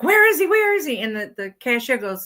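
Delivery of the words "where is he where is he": put-on high, worried calling for the boy; mock alarm, high pitched; frantic mother. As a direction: imitate a panicked mom searching. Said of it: "So she's like".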